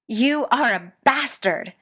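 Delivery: slow, loud seething fury; incandescently furious. A narrator's reading. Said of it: "incandescently furious".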